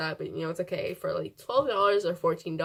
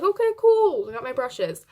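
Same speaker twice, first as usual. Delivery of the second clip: excited; 5 semitones higher, 4 dB louder than usual. Retold or imitated. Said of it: "I was like".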